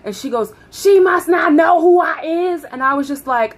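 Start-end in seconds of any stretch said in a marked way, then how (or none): 0.7-2.6 high, loud imitation of a yelling supervisor; retold screaming. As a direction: holler it from the back room. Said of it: "She's now screaming in the back".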